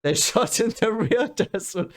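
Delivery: real amazed laughter mid-sentence. Real, not acted. Amazed at desert shooting.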